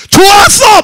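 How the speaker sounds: high, loud angry shout; full-voiced yell at the mechanic; shouting angrily. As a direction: yell it back in a heated argument. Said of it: "목소리가 올라가는 거야".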